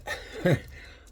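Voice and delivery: breathy amused chuckle; light laugh at the name; quick unvoiced laugh; short laugh. Real, not acted.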